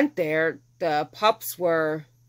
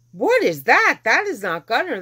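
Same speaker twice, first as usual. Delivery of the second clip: high, loud, astonished reaction; startled disbelief; 7 semitones higher, 6 dB louder than usual. Retold or imitated. Owner hears an unknown yip at the vet, startled disbelief. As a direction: blurt it out, baffled by the sound. Startled retelling at hearing yips.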